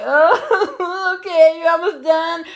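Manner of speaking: high, wavering, sing-song whimper; sob-laugh it out way up high; laugh-crying through pain. Retold or imitated. Getting tattooed and in pain, high laugh-crying whimper.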